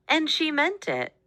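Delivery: ordinary delivery, nothing marked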